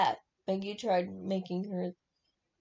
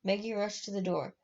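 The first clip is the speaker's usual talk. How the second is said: ordinary delivery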